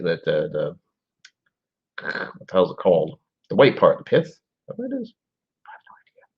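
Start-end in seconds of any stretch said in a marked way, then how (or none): 4.3-6.2 a whispered, shrugging admission. Real, not acted. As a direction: breathe it out without voice. Unsure aside about the pith.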